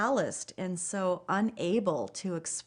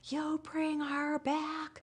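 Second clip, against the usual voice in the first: high, drawn-out cry held back in volume; thin, high imitation of screaming upward; retold anguished scream; 8 semitones higher, 4 dB quieter, much slower than usual. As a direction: re-enact a scream softly, stretching each word high and slow. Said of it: "screaming at the top of my lungs".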